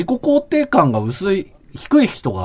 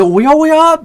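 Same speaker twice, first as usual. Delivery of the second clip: puzzled surprise; 11 semitones higher, 6 dB louder, slower than usual. Retold or imitated. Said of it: "みたいな感じの違和感".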